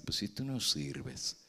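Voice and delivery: quiet, menacing devil murmuring sins; devil's accusing voice. Retold or imitated. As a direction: whisper accusations in the ear like the devil. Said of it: "Y nos va a decir".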